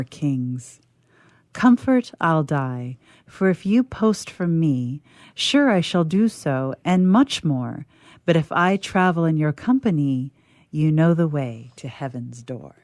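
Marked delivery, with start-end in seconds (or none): none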